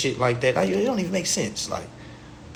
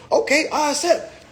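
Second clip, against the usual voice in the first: much higher, laughing mock voice; mock acceptance; 11 semitones higher than usual. Plug accepting the bill, high laughing mock voice. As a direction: say it like a naive fool agreeing. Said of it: "instead of saying".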